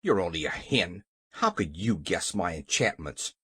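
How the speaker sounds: low, scornful King voice; dismissive. A narrator's reading. King doubts the hen can guess enchantments, dismissive. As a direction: drop low and belittle.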